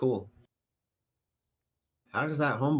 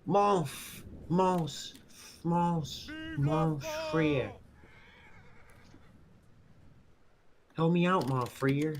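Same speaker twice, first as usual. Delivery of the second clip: high, hammed-up French accent; french chef; 6 semitones higher, slower than usual. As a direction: stumble through bad French. Stumbling mock French.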